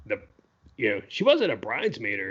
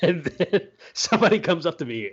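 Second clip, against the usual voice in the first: chuckling through the words, a bit higher and louder; laughing; 9 semitones higher, 6 dB louder than usual. Real, not acted. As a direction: laugh as you set up the punchline. Laughing while telling the story.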